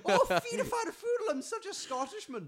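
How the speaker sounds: very high, loud mock Scottish; silly high-pitched Scot; bad Scottish accent. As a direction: squeal out a badly faked Scottish accent. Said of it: "I can't do accents".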